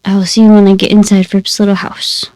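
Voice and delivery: usual speaking voice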